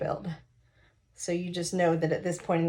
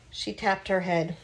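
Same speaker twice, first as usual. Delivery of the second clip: ordinary delivery, nothing marked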